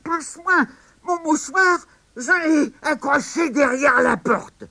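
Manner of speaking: put-on very high old woman's voice; sick granny giving an order; old grandma. Grandma asking grandson for her hanky, high old voice.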